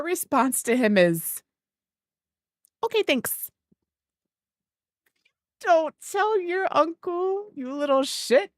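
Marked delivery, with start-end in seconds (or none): 2.8-3.5 high, flat deadpan thanks; curt teen reply. Retold or imitated. Niece brushing off her uncle's gift message, deadpan. 5.6-8.5 high, slow teasing scold; playful mock scold. Aunt teasing her niece about the uncle, mock scolding.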